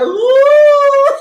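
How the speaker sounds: high, loud mock squeal of delight; crazy squeal. Mocking someone acting crazy with a loud squeal. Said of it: "acting crazy".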